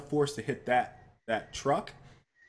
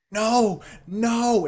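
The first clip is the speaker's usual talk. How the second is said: higher and louder, frantic cries; panicked warning; 9 semitones higher, 6 dB louder than usual. Retold or imitated. Truck owner's girlfriend waving off the speeding car, panicked. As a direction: wave him off in a panic. Woman waving her hands.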